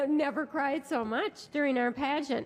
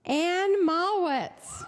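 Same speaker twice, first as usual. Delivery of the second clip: announcer shout; 4 semitones higher, much slower than usual. Acted. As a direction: belt the winner's name to the hall. Emcee announcing runner-up names.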